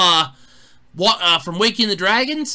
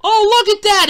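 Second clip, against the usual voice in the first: normal talking voice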